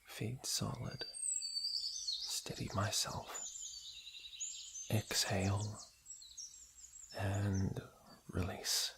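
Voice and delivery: slow hushed self-talk; whispered focus. Acted. Princess steadying herself before releasing the arrow, whispering. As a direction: whisper to yourself, steadying the aim.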